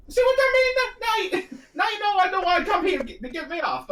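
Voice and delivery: high, fast Italian plumber voice; squeaky mock Mario. Mario voiced again, reread.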